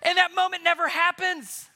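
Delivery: loud, high and building, preacher at full tilt; excited. Real, not acted. Preacher shouting to the congregation about the epic battle. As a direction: swell up to the big moment, loud and high.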